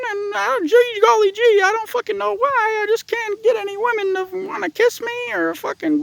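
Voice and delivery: very high falsetto, mocking a hapless loser; hammed-up high-pitched complaint; whiny virgin caricature. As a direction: squeak it out like a pathetic grown man. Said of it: "If you talk like that".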